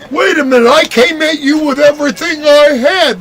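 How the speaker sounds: high-pitched mock protest; beaten opponent. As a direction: protest high, as the bewildered loser. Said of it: "they're like".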